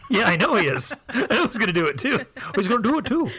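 high, sing-song Wisconsin insistence; hammed-up Wisconsin gossip; Wisconsin accent. Shown as a demonstration. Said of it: "what do they say".

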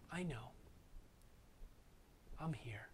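very soft, low, near-whispered comfort; quietly consoling, almost a whisper; tender reassurance. Retold or imitated. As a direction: let it fall to a hush, as God gently reassuring. God's comfort voiced in her story.

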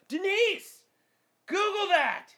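high summons to Denise; calling out. Pretend. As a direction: yell for the secretary across the office. Calling out to pretend assistant.

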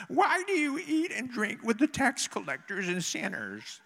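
high, slow mock Pharisee whine. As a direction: draw it out as a high-pitched, hammed-up Pharisee. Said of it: "I have a Pharisee voice".

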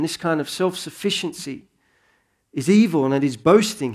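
ordinary talk

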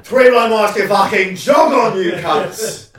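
slow, loud jeering shout; mock punk shout. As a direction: bellow it like a punk singer. Joins the mock punk cover.

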